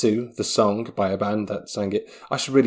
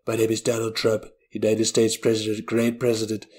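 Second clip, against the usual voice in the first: lightly voiced Trump; a hint of Trump bluster; Donald Trump impression. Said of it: "doing Donald Trump impressions".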